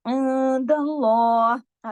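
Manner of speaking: very slow इंदल्लाह with a full nasal ghunna; ikhfa recitation. Shown as a demonstration. Teacher showing full ghunna to students, slow chant. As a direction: hum through the nun. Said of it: "गुन्ना कामिला हो जाता है".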